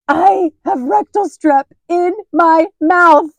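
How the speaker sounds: high, loud, slow mock horror; horrified. Mom realizing where her strep came from, horrified high wail. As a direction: wail it out in disgusted dread. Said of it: "I was just horrified".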